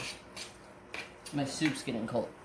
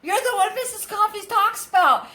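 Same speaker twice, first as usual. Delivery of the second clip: high, louder squeal of recognition; excited fan; 5 dB louder than usual. Retold or imitated. Cashier recognizing him from the channel, high excited imitation. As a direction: imitate a thrilled fan, voice pitched way up. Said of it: "they both start, like, jumping up and down".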